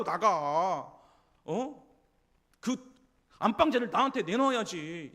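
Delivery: loud, scornful tirade, building to a shout; rival wife's taunt. Retold or imitated. Rival wife yelling at barren Hannah to get out, loud and scornful. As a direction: bark her out of the house with contempt.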